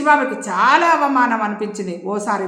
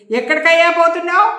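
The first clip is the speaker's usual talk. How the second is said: loud shout; 7 semitones higher, 7 dB louder than usual. A narrator's reading. Manager yelling at his clerk as he leaves, loud shout. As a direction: bellow it out, much higher and louder. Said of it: "గట్టిగా అరిచాడు మంగారావు".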